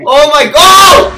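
very loud, high, drawn-out scream; terrified scream. Real, not acted. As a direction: shriek at a jump scare. Jump scare in horror game.

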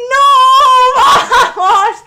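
high, very loud cries of disbelief; euphoric shouting. Real, not acted. Streamer celebrating the Mbappé card, euphoric shouting. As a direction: whoop and yell in celebration.

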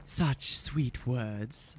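slow, soft, almost breathed; whispered. A narrator's reading. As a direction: hush it slowly, savouring each word. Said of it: "Faolain whispered".

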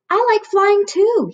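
little bear voice. A narrator's reading. Cub riding on mother's shoulders, high and eager.

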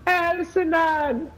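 squeaky high drawn-out name; mock high voice. Taunting an opponent, squeaky high voice. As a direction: sing the name up high and slow.

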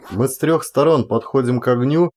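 ordinary delivery, nothing marked

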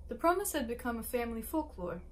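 usual speaking voice

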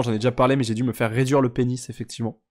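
usual speaking voice

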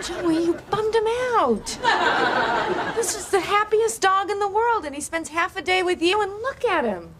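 high-pitched, indignant reproach; exasperated scolding. Acted. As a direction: scold a friend for upsetting the dog. Scolding him for saddening the dog.

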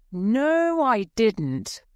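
high-pitched, emphatic protest; loud denial. A narrator's reading. Knave denying he wrote the letter, loud protest. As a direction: raise the pitch and deny it forcefully. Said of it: "said the knave loudly".

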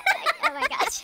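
slow, laughing oh my gosh. Real, not acted. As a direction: laugh through the words. Friends laughing at fall mishap.

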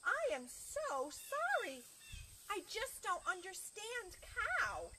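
slow, high, regretful apology; apologetic librarian. Acted. Librarian tells the cow she doesn't understand, apologetic. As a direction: apologise kindly, high and drawn out. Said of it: "very friendly children's librarian looked".

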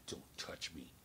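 low, soft threatening whisper; menacing whisper. Retold or imitated. Stranger warning Limo Bob off, low whisper. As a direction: hiss it as a cold warning. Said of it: "He goes".

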